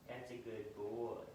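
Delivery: slow tender praise to a puppy; slow soothing praise. Real, not acted. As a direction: draw it out gently to calm him. Praising dog who came close.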